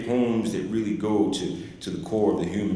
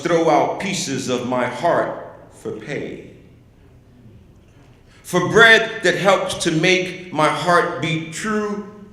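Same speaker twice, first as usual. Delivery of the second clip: very slow, emphatic declamation, raised in pitch; poem recital; 6 semitones higher, 4 dB louder, slower than usual. Acted. Poet reads Poet to Patron in honor of a friend, slow declaimed poem.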